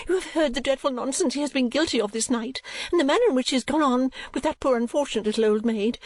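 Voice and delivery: high, prim, self-important lady; a mother convinced of her own charms; pompous rambling lady; fussy matron. A narrator's reading. Mrs Nickleby lectures Kate.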